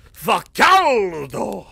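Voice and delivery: slow, drawn-out Italian "Facaldo"; Italian teacher. Retold or imitated. Mimicking his Italian teacher complaining of the heat, slow. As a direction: stretch it out like a sweating teacher. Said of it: "That's my Italian teacher used to do it".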